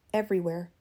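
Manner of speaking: usual speaking voice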